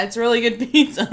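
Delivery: giggling through the words, loud; can't stop laughing. Real, not acted. Both laughing after bad accent.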